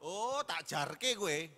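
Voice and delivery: normal talking voice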